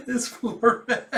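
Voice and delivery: high, laughing words; laughing pity. Real, not acted. Reader pitying poor Lloyd, laughing through the words.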